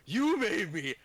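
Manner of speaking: high-pitched mock-angry outburst; angry Crunk. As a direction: rise sharply into hammed-up anger. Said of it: "Crunk's gonna turn around and just".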